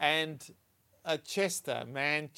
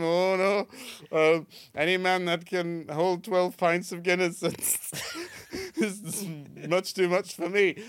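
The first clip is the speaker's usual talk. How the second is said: louder Yorkshire husband; Yorkshire accent; 4 semitones higher, 5 dB louder, slower than usual. Acted. Husband in a joke refuses to confront the man, Yorkshire accent. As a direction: back out of the fight in Yorkshire. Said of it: "the Yorkshire accent really makes it".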